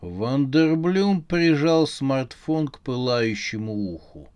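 ordinary delivery, nothing marked

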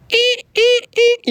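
high squeaky crank sounds; window crank noise. Shown as a demonstration. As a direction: imitate winding a window handle. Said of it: "Такие и".

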